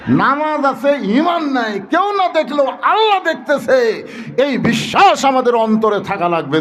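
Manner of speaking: high, ringing sermon voice; impassioned preaching. Real, not acted. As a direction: press the point on the congregation. Preaches at full pitch.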